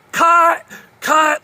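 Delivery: high, loud shouted stop call; shouting cut. Retold or imitated. A film crew shouting at the intruder, loud cry of cut. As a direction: yell it across the whole set. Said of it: "they start yelling at him".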